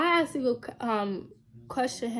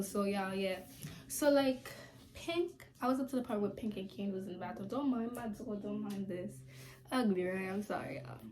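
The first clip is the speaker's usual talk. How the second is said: ordinary talk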